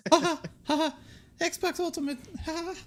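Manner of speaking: high-pitched Mickey laugh and line; Mickey Mouse voice. Pretend. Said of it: "Can you just do it as Mickey Mouse?".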